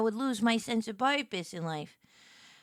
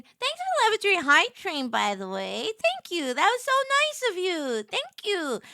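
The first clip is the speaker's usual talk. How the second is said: excited thanks; 7 semitones higher than usual. Real, not acted. Thanking viewers for hype train.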